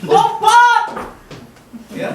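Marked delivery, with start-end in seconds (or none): 0.1-1.0 high, hyped-up shouted commands; song leader. Acted. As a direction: rouse the congregation to move. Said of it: "Song leader bilang".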